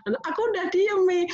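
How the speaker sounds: put-on sobbing child. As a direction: play the little one still hiccuping from tears. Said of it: "suaranya masih sesengguhan begitu".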